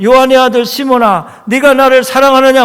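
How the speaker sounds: loud, ringing question from Jesus; preached question. Acted. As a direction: call it out to the congregation. Preacher voices Jesus, raised. (설교하듯 크게 외치며)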